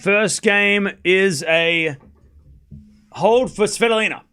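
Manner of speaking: usual speaking voice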